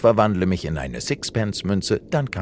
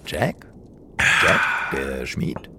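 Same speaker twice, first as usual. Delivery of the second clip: mysterious whisper; 5 semitones lower than usual. Acted. Stranger approaches Jack mysteriously.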